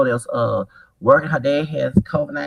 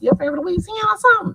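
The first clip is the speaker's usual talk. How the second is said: high quick question; faster than usual. Retold or imitated. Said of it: "I said".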